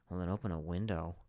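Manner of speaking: muttered sarcastic aside; under his breath. Retold or imitated. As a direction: mutter it quietly to yourself. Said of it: "who just says under my breath".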